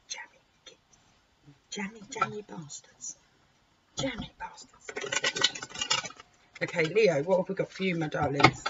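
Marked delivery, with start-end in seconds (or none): 0.1-4.9 envious muttered aside. Real, not acted. Envious aside about lucky cards.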